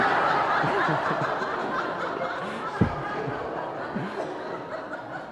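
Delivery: soft airy chuckle at his own punchline; breathy laugh. Real, not acted. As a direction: let a quiet breathy laugh roll out.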